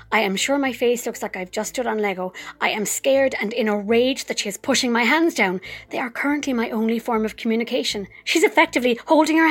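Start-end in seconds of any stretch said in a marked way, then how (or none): none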